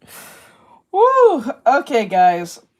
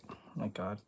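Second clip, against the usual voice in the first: whisper; 22 dB quieter, faster than usual. Real, not acted. Misreads price, whispers to self.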